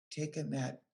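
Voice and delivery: plain reading voice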